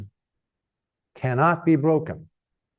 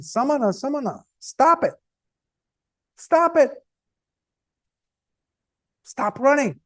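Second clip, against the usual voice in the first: high-pitched yell, held back in volume; mock yelling; 9 semitones higher than usual. Angulimala calling after the walking Buddha, high mock yell. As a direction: call out high after someone out of reach. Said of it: "he starts yelling".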